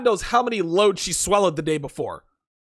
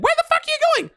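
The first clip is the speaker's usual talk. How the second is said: much higher, shrieking imitation of her yell; mock yell across a lot; much faster than usual. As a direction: scream it at him across the parking lot. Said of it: "She yells across the parking".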